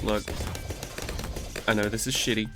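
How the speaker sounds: ordinary talk, nothing marked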